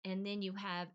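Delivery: usual speaking voice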